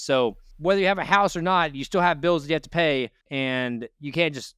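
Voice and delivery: usual speaking voice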